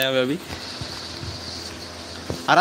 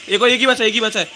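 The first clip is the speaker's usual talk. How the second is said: excited callout; 7 semitones higher, 3 dB louder, faster than usual. Real, not acted. Callout in a gunfight.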